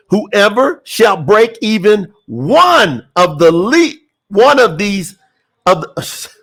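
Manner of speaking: slow, deliberate scripture reading. Real, not acted. Preacher rereading the verse for the congregation, slow reading. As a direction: read it slowly, holding the key word "one". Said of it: "I'm gonna read it slow".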